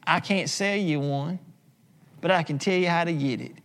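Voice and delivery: normal talking voice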